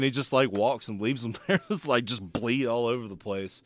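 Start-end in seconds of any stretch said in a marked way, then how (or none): none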